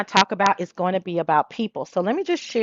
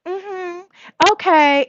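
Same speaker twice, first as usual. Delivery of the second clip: high dry mm-hmm then louder okay; mock Tracy; 9 semitones higher, 5 dB louder, slower than usual. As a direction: imitate Tracy's sass. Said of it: "And she's like".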